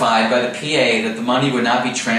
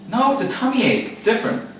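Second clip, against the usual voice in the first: whiny insistence; 9 semitones higher than usual. Real, not acted. Insisting to Emma, second take.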